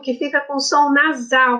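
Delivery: normal talking voice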